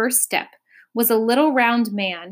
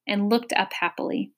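usual speaking voice

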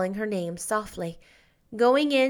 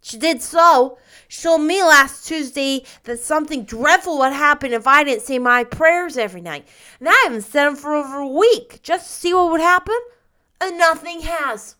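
put-on louder, aggrieved insistent boy; 6 semitones higher, 9 dB louder than usual. A narrator's reading. Davy insists to Anne nothing happened, aggrieved. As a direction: hurry it out, louder, feeling wronged. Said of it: "in a conclusive and aggrieved tone".

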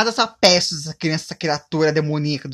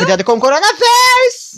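much higher, loud mock alarm; shouted warning; 10 dB louder than usual. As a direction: yell it as a goodbye. (aviso gritado, bem agudo e alto)

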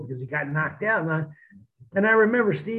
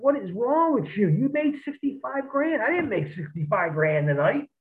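higher, exasperated retelling of a buddy's scolding; mock exasperated rant, voice pitched up; retold disbelief; 7 semitones higher than usual. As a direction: scold him like a buzzed friend who can't believe it. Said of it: "Steve was just like".

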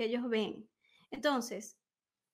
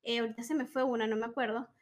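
normal talking voice

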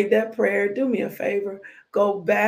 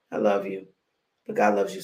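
deep voice; 13 semitones lower, faster than usual. Acted. Repeated I love you line.

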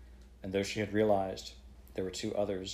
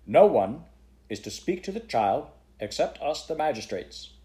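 a firm, louder, higher command; stern order; 7 semitones higher, 7 dB louder than usual. A narrator's reading. Chairman to the whole court, stern order. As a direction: lay down the rule to the room. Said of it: "he ordered".

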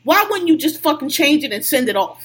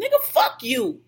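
angry retort; 12 semitones higher than usual. Real, not acted. Angry reply to a coworker's pep talk.

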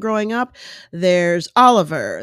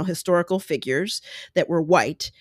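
ordinary talk, nothing marked

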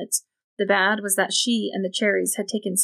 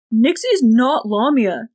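slow, forceful, much louder cry; almost shouting in defence of his friend; loud and slow, stressing each word; near shout; 4 semitones higher, 8 dB louder, slower than usual. A narrator's reading. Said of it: "Jordan all but shouted".

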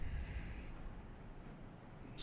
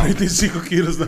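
excited laughing; 6 semitones higher, 10 dB louder, faster than usual. Real, not acted. Amazed at the weights.

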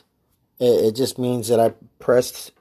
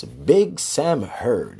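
slow drawn-out sign-off; much slower than usual. Shown as a demonstration. Host settling on how to say his show name, slow and drawn out. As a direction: draw Big Sam Heard out, slow and settled.

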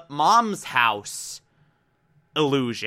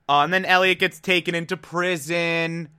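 normal talking voice

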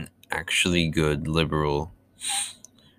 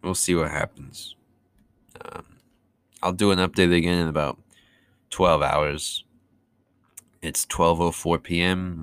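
ordinary talk, nothing marked

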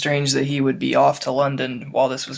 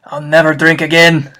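loud, booming half-giant voice; loud rough gamekeeper voice; Hagrid voice; 2 semitones higher, 10 dB louder than usual. Acted. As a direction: boom it out like a big-hearted giant in tears. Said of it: "in Hagrid voice".

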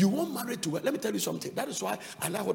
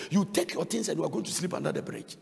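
ordinary talk, nothing marked

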